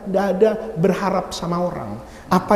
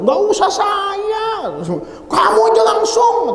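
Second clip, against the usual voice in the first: high, drawn-out mock shout; emphatic reassurance; 12 semitones higher than usual. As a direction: wave the request off in a raised, high voice. Emphatic reply to favour-seeker. (seruan meyakinkan, nada tinggi)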